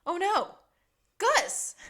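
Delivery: suddenly remember Gus, hammed-up; mock alarm. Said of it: "and he goes".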